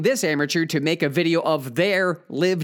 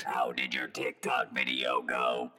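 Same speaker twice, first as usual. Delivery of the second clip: deep mocking voice; 10 dB quieter, slower than usual. Host voices system members asking.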